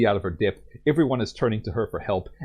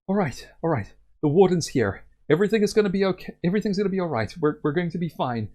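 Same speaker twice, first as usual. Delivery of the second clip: relieved villagers; 8 semitones higher, faster than usual. Acted. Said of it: "they're like".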